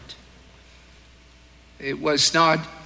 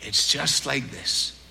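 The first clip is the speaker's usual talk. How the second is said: gentle lilting Irish voice, slowed and easing down to a hush; an old Irishman explaining kindly, in a lilting Irish brogue; 3 semitones lower than usual. Retold or imitated. Old Bible teacher answers the young preacher in a lilting Irish voice. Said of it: "in his little Irish lilting voice".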